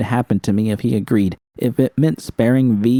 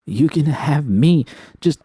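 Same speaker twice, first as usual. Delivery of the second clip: desperate offer; 6 semitones higher, slower than usual. A narrator's reading. Begging the villain.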